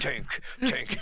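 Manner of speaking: mock orc marching chant, building; oncoming orc. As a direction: chant it like an approaching pretend tank. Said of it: "hears under the orc's breath as the orc's coming".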